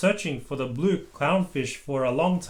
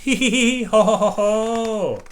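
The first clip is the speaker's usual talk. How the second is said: laughing; 7 semitones higher, 4 dB louder than usual. A narrator's reading. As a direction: laugh it out merrily. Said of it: "Everyone laughed".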